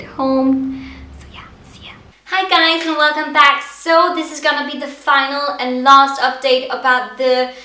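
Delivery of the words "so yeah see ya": hushed whisper at work. Real, not acted. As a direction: keep it to a whisper. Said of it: "I'm sorry I have to whisper".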